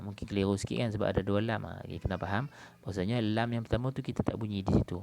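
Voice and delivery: ordinary talk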